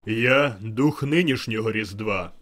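slow, booming spirit voice; grand proclamation. A narrator's reading. The spirit introducing itself to Scrooge, slow booming proclamation. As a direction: announce yourself loudly and grandly.

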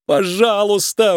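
high, drawn-out plea; begging. A narrator's reading. Nick begs the mob boss for mercy, high and pleading. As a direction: stretch the word out, imploring. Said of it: "умолял Ник".

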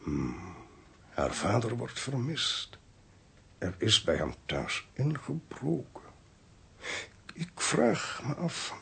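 slow and soft, piecing clues together; peinzend hardop redeneren. Acted. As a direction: muse aloud slowly, softly. Legt peinzend verbanden tussen de aanwijzingen. (langzaam en zacht, peinzend)

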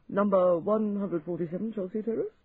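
puzzled echo, rising at the end; surprised and baffled. A narrator's reading. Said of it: "surprised and baffled".

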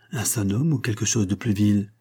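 ordinary delivery

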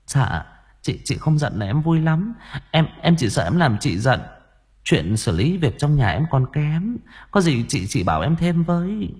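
butter her up, overly familiar; ingratiating. Acted. Junior wife flattering the elder wife, fawning. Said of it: "kiểu nói nịnh nọt, thân mật".

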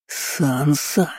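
drawn-out hissed warning; hissing. A narrator's reading. Uncle out of patience with his niece, hissing. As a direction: hiss the name, lifting the end. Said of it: "зашипел жидкобородый".

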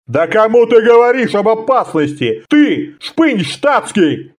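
high, indignant outburst; flaring up. A narrator's reading. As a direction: lose your temper at the civilian. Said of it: "вспылил офицер".

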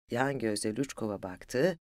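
plain reading voice

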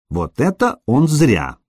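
ordinary delivery, nothing marked

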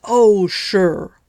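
loud sarcastic roar; father bellowing near the door. A narrator's reading. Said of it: "roared the voice of her father".

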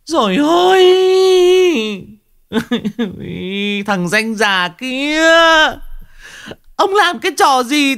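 screeching. Acted. Wife screeching at her husband over the hearse, high wail. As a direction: shriek and wail at him in despair. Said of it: "mà rít lên".

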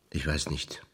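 very soft, low near-whisper; hushed uncertainty. Acted. Searching with a partner for where they are, hushed and unsure. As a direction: let it sink almost to nothing. Actor falters, searching for words.

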